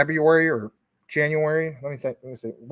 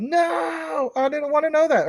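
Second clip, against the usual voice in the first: high-pitched wailed protest, hammed up; mock wailing; 13 semitones higher than usual. Fan hearing the show got canceled, mock wail.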